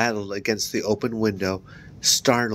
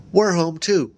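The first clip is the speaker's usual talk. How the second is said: high, louder woman's call; mother calling; 9 semitones higher, 5 dB louder than usual. A narrator's reading. Mother calls from downstairs.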